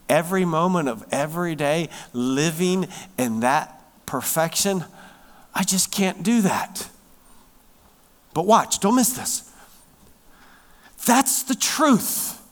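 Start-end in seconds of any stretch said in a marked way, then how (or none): none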